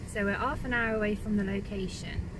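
normal talking voice

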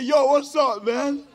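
high, loud yo; a cool, slangy hello; casual street greeting. Retold or imitated. Dying to greet the concierge, a loud imitated yo. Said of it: "I was dying to say".

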